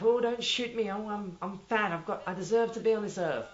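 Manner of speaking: lightly voiced fat boy begging for his life; mock begging. Drama teacher voicing a fat boy before the firing squad, mild mock pleading. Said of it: "sort of begging for his life".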